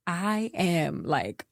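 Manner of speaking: ordinary talk, nothing marked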